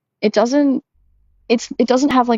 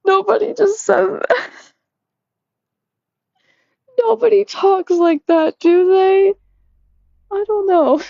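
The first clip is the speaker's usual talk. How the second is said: much higher, amused disbelief; incredulous; 12 semitones higher than usual. Real, not acted. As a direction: react like you can't believe it said that. Reacting incredulously to the bot.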